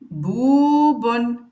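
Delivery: "Boubonne" said slowly and clearly; model word. Shown as a demonstration. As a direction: pronounce "Boubonne" for learners. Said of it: "je vais venir prononcer chaque lettre".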